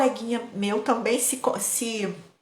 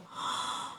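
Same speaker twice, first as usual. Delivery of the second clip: gasp; 12 dB quieter than usual. Retold or imitated. Retelling the fright.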